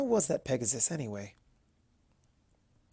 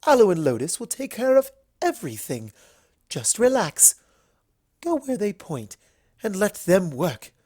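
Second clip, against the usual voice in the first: higher, louder, bright reassurance; taking charge of the spa visit; confident hostess; 6 semitones higher, 6 dB louder than usual. A narrator's reading. Rarity reassuring Luna.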